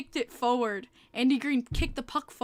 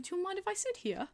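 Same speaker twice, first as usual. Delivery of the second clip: polite British man, higher and quick; quick British impersonation, pitched up; British accent; 8 semitones higher, 6 dB quieter, faster than usual. Retold or imitated. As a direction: put on a soft, high British accent. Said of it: "He was British".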